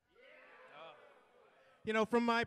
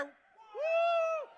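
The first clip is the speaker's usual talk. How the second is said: cheering; 4 dB louder than usual. Real, not acted. Manager hyping the countdown, excited cheer. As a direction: hype countdown, high and loud. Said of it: "Let's cheer".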